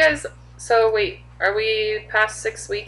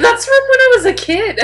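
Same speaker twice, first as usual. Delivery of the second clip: high, loud retold outburst; mock disbelief; 9 dB louder than usual. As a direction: exclaim it as if you can't believe it. Retells disbelief at the old commercial.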